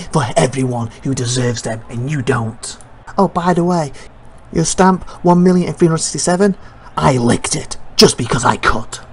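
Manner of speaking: low, menacing wrestler voice; defiant wrestler promo. Acted. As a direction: deliver a grim vow of revenge in a low voice. Voices wrestler's promo against abuser.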